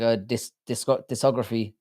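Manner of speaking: normal talking voice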